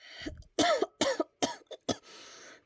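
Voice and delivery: short cough after a sigh; a few weak coughs; slight coughing fit. Acted. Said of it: "a slight coughing fit".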